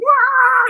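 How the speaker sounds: mock Amazon battle shriek; imitated war cry. Showing Donna going full on Amazon, imitated high war cry.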